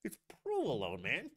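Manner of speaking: strained, high-pitched exasperation; squeaky mock outburst of annoyance; exasperated. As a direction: cut her off, fed up. Exasperated reply to the mocked cook.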